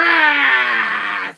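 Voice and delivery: loud, high maniacal cackle; villain laugh. Acted. Streamer bursting into his villain's loud maniacal laugh. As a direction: let out a triumphant evil laugh.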